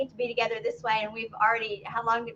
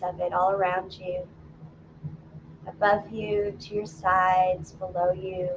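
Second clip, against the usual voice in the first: calm, lower meditation voice with long pauses; guided meditation; 4 semitones lower than usual. Acted. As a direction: guide them gently inward, phrase by phrase. Leads a grounding meditation.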